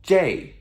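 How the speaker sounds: "J" like English "jay", falling sharply; colloquial pronunciation. Shown as a demonstration. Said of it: "usually pronounced as J".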